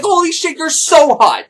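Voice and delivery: high-pitched, gushing protest; frantic reassurance. Retold or imitated. Man reassuring his offended lover, frantic. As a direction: blurt it out high to placate her.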